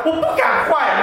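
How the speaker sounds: high, loud laughing admission; laughing confession. Real, not acted. Preacher laughing at himself for being too timid to sin, high and loud. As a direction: laugh at your own timidity.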